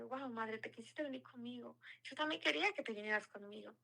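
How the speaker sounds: softly and lovingly, as if to a mother; tender murmur to Mary. Retold or imitated. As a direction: hush it, speaking close to her. Talking tenderly to the Virgin.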